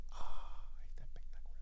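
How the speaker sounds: soft whisper of admiration; whispered delight. Real, not acted. Host relishing the quote she just read, whispered. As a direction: whisper it to yourself, savouring the line. Delighted murmur after reading.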